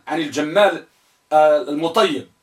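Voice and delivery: ordinary delivery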